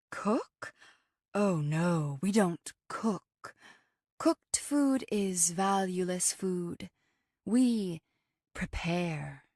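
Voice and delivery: slow, deliberate correction with a touch of horror; Moonbliss, horrified. A narrator's reading. Raw vegan corrects someone who said cook, mildly horrified. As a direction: draw the words out, gently appalled. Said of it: "Moonbliss looked horrified at our exchange".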